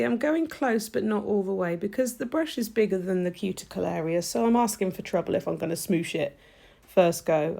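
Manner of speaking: usual speaking voice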